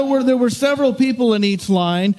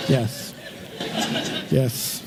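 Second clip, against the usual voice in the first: softer, lower, laughing through it; chuckling soft concession; sheepish laughing yes; 7 semitones lower, 8 dB quieter than usual. Real, not acted. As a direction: admit it with a chuckle.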